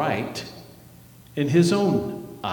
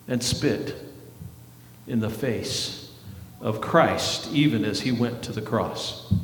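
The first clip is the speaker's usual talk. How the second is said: low, soft, somber preaching; quiet, lowered voice of grief; subdued, heavy delivery; 6 semitones lower, 8 dB quieter than usual. Real, not acted. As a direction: drop low and hushed, gravely serious. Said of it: "you want me to talk like this the whole time?".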